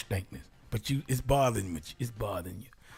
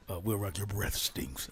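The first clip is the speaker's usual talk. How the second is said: whispered, low, plain truth; blunt whisper; 6 semitones lower, 5 dB quieter than usual. Acted. Telling Will Rock his breath stinks, low whisper. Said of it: "could you break that down to me?".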